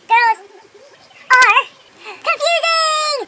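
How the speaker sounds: "confusing" stretched very slowly and high; a mock dramatic reveal, with "are" hit loud; drawn-out punchline. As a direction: build suspense, then draw out "confusing". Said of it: "punchline built up slowly".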